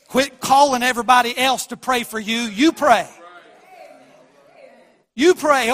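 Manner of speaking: loud, high pulpit voice; preaching at full force. Acted. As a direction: drive it home to the congregation.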